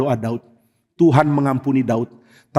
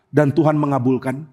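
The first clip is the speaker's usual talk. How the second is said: usual speaking voice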